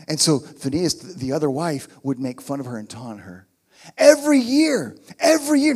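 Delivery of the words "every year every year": Every year said much higher and louder; emphatic every year. Shown as a demonstration. Pastor stressing the yearly taunting, high and loud. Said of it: "notice it said that".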